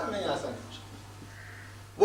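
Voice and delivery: soft whispered disbelief, slowly; whispered denial. Acted. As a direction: murmur it like a doubt to yourself. Voicing the doubters' inner denial.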